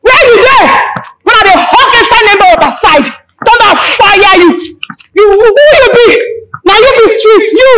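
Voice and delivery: angry insults. Acted. Woman cursing her opponent after a street quarrel, angry tirade. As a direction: hurl each insult at her.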